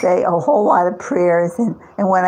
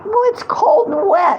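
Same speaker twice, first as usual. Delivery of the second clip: shrill complaint; 14 semitones higher, 4 dB louder than usual. Real, not acted. Cold water on her.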